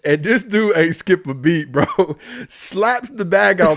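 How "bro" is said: ordinary talk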